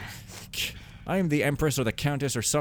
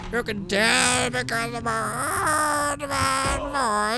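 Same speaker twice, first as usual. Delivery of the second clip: put-on high, slow creaky old man voice; 14 semitones higher, 3 dB louder, much slower than usual. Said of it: "voice that I've never used that voice".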